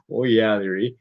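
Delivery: playful put-on Canadian accent. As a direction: lean into a mock Canadian accent. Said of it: "if I can really keep this accent up".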